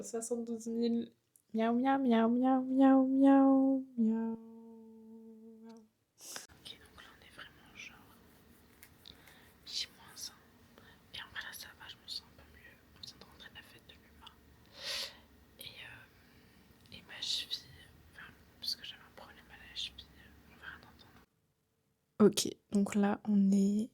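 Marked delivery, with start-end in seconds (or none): 1.5-4.4 cat meowing. Pretend. 6.5-22.3 hushed late-night whisper; breathy whisper close to the mic. Real, not acted.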